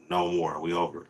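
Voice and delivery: normal talking voice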